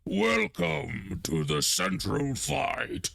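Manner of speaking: a hint of an orc host, stretched slowly; lightly voiced orc, drawn out welcome; orc greeting. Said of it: "one of the orcs goes".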